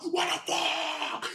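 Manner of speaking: long drawn-out, held-back yell; slow-stretched imitated panic shout; panicked mock scream. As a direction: stretch it out like screaming at a safe volume. Retells panic in runaway car.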